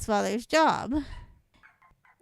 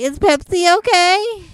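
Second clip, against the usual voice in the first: imitated waiter; 7 semitones higher, 12 dB louder than usual. Waiter asks about Pepsi.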